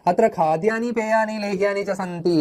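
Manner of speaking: slow, measured Sanskrit recitation. Acted. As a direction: read each word out carefully. Reading the Sanskrit message. (പതുക്കെ സംസ്കൃത പാരായണം)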